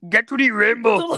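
Arnold Schwarzenegger. Acted. Action star ordering everyone to the rainbow, slow strained yell. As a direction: stretch the command like an action hero. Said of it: "Arnold Schwarzenegger".

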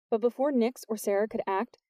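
usual speaking voice

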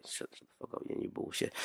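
whispered, hissed aside. Real, not acted. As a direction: whisper it under your breath. Muttered aside to cohost.

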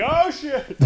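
high squeaky oh shit; caught out. Acted. Conspirator caught faking, squeaking in alarm. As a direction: yelp it like a busted kid.

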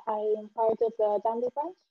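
normal talking voice

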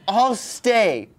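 high, loud show of defiance; defiant mock quote. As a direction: dig in as the heroine, hammed-up. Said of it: "And then she's like".

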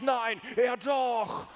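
slow, drawn-out high shout of disbelief; boy shouting at the angel in his room, stretched slow shout; startled shout. Retold or imitated. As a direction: cry it out stretched long in shock. Angel appears at night.

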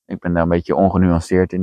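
usual speaking voice

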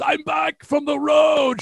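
triumphant drawn-out homecoming shout, high and loud; triumphant call. Retold or imitated. Husband bursting in home from tour to his wife, triumphant call. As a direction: announce yourself grandly, stretching the words.

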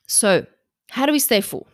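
normal talking voice

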